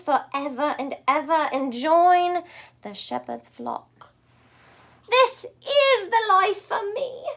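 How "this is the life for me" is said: high, loud, slow joyful cry. Acted. Pig deciding to join the flock, joyful cry. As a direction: cry it out with delight. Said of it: "he cried".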